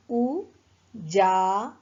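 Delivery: Ujala broken into slow stretched syllables; slow syllable reading. Shown as a demonstration. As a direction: sound out Ujala slowly for children. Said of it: "उच्चारण करते हैं".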